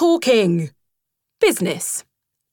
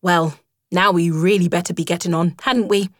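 careless remark. A narrator's reading. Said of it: "remarking carelessly".

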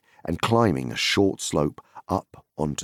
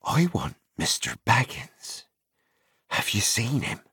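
a lightly voiced muffled rider, slightly higher; 7 semitones higher than usual. A narrator's reading. Merry disguised in the fog, lightly muffled. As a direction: speak from behind a scarf. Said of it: "said a muffled voice".

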